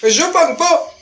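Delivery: high, impatient nagging voice; annoyed moviegoer. Acted. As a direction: nag a friend for running late. Voicing late moviegoers.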